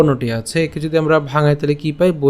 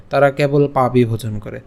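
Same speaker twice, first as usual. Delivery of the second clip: ordinary talk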